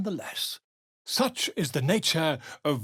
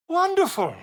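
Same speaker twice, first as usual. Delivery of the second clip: delighted cry; 12 semitones higher, 4 dB louder than usual. Acted. Delighted game character exclaims.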